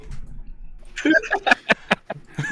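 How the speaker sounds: high wheezing laugh; breathless laughter. Real, not acted. Laughing at joke.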